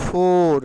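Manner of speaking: loud, long "ফোর" with its R; "ফোর" drawn out loudly as the mistake; dragged-out wrong form. Shown as a demonstration. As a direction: stretch "ফোর" right through to the R. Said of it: "টেনে বলবো না".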